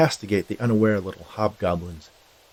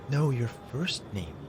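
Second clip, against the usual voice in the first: slow, higher-pitched woman's voice; softer, higher, patient correction; woman correcting a boy; 4 semitones higher, 7 dB quieter, slower than usual. A narrator's reading. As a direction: draw it out, correcting a child.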